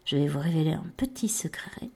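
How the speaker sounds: conspiratorial whisper; secret whisper. Pretend.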